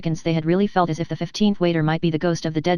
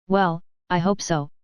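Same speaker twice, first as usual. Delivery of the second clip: plain reading voice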